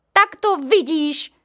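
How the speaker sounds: mother snapping at Honzík. A narrator's reading. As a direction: snap at the boy. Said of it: "vyjela na Honzíka".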